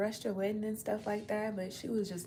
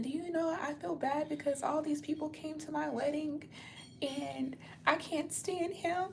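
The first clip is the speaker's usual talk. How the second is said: imitating Kim crying; 8 semitones higher than usual. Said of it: "Y'all know how Kim Kardashian cries".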